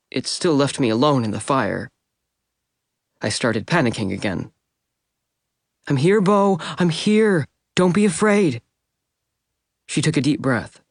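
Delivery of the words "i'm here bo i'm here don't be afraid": urgent tender reassurance; urgent comfort. A narrator's reading. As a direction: hold him steady with your voice. Edith calming panicking Bo.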